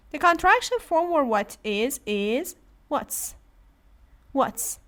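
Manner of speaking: normal talking voice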